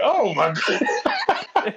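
slow, high, laughing "oh my God"; stunned laugh at the vanished clerk; laughing disbelief. Retold or imitated. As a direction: drag it out through a laugh. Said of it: "I was like".

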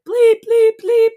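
very high, loud mimicked bleeps; imitated censor bleeps. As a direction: blast out beeps like TV censoring swearing. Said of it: "'cause it was like".

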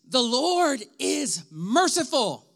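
slow, solemn proclamation of a verse; proclaiming scripture. Shown as a demonstration. Pastor proclaiming scripture to the congregation, slow and solemn. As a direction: trumpet the line out slowly, like scripture read from the pulpit. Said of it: "I'm gonna try to proclaim it".

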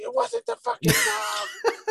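very high, slow falsetto; screechy mock mother, drawn out; outraged mom. Mom rejecting son's dog excuse, high screech.

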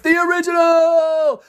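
high, loud, drawn-out sign-off cry; announcer catchphrase. Real, not acted. Host signing off with his title, big announcer flourish. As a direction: hold it long like a ring announcer.

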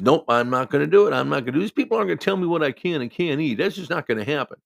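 loud, stubborn refusal; patient ranting at the nurses; ranting and raving. Retold or imitated. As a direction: imitate a patient ranting like a banshee. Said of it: "ranting and raving".

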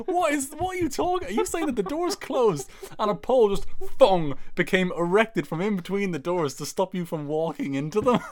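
high, laughing disbelief; can't believe what he's hearing; incredulous. Real, not acted.

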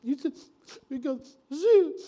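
put-on a high, loud, sniffly little-boy wail; imitated sniffling boy. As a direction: wail it like a heartbroken little kid. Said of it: "And he's sniffling".

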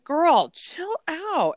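high, drawn-out mock plea; exasperated telling-off. Host answering an overexcited girlfriend, exasperated and drawn out. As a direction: stretch it out high, fed up.

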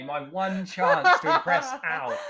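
slow, laughing lament; amused groan at his own failure. Real, not acted. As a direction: laugh through the self-mockery.

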